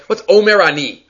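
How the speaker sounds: ordinary talk, nothing marked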